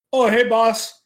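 playful retold call-out; imitated cheery greeting. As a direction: call it down like a tipsy giant hoisted in the air. Drunk Andre greets Rob while lowered.

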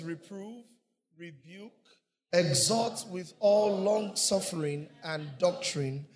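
slow, solemn reading of scripture. Acted. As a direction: read the verse out slowly and firmly. Reading scripture aloud together.

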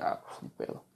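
whispered to himself, resigned; a very soft inner whisper. Retold or imitated. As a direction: breathe it out under your breath. His resigned inner thought.

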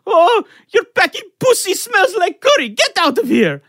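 high, loud mock Pakistani accent. Roommate jeering at his girlfriend, mock Pakistani accent. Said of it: "begins giving a Pakistani accent".

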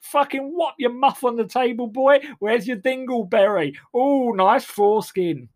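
loud, high, crude lad voice; crude working class lad. Acted. Arriving among Oxbridge writers, loud crude lad. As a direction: bellow it like a crude bloke. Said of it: "I come in and go".

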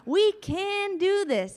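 overjoyed hype voice for the crowd; grinning cheerleader. Acted. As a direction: gush it out through a huge smile. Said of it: "just smiling like".

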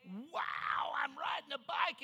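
put-on a little boy's cry of joy; excited kid. Child thrilled to be riding the bike, excited shout. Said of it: "And I say".

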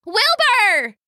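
high, drawn-out angry call; shouted name. A narrator's reading. Sister at the doorway calling her runaway brother, shouting. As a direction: holler the name after him. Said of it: "she shouted again".

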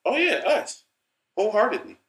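high squeaky "yeah, us"; mock enthusiasm. Joking to cohost about the mix-up, high mock cheer. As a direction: play along with the joke. Repeats joke in put-on voice.

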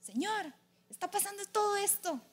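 acted complaint in prayer; venting prophet. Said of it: "Elías se desahogó así con Dios".

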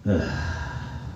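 soft, low exhale; long deflated sigh; heavy sigh. Acted. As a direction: let out a quiet, weary sigh. Sighs after confessing credit score.

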